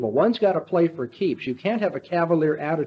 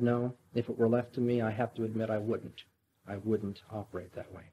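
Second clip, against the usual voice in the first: softer, hushed reluctant confession; quiet admission; 6 semitones lower, 9 dB quieter than usual. Retold or imitated. The monk quietly concedes to Ivan, hushed. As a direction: admit it quietly, trailing away. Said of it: "Elisha the monk ... said".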